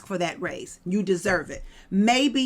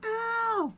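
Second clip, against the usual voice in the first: singer's "ow" ad-lib; 13 semitones higher, 7 dB quieter than usual. Retold or imitated. Ad-lib after singing [name].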